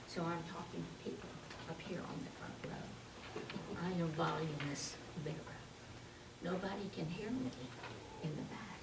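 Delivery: barely audible, quick, near-whispered; tiny voice with no volume; Voluminous Vera. Shown as a demonstration. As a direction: talk only to the front row. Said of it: "unable to speak loudly enough".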